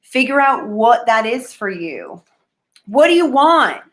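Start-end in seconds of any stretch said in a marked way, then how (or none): 2.9-3.8 louder challenge. Real, not acted. Blurting the question to viewers.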